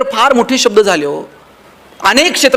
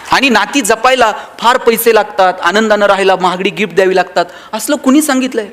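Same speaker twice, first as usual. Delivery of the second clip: ordinary talk, nothing marked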